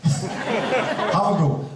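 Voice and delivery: laughing, high and very slow. Real, not acted. Host laughs as he invites the audience to join in, high and slow. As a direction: laugh it out as you invite them.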